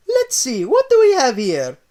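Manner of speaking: high, loud Italian plumber; Mario browsing, high Italian voice. Acted.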